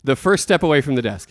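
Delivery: ordinary talk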